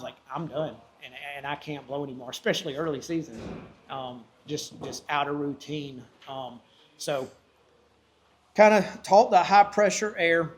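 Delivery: usual speaking voice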